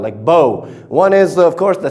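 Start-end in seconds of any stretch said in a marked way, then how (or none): 0.2-0.7 "bow" rhyming with go, low pitch; O sound. Shown as a demonstration. Teacher repeating the noun for students, "bow" with long O. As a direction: say "bow" as boh, lower. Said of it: "O sound".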